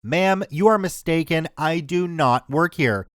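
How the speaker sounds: plain reading voice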